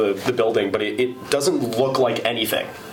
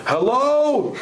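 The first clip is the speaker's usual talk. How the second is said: imitating a old man's high, quavering hello; old caller; 13 semitones higher than usual. Retelling the phone call, old man caller's hello. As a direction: mimic the seventy-year-old caller answering. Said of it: "and he goes".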